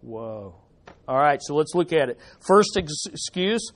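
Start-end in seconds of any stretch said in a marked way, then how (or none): 0.0-0.6 awed hush. Shown as a demonstration. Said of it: "We ought to be saying".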